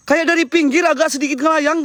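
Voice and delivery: ordinary talk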